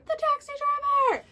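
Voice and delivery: very high squeal of disbelief; squealed repeat. Real, not acted. Repeating to viewers the taxi driver twist, squealing. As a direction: top the first reveal with a shriek. Repeats the reveal even higher.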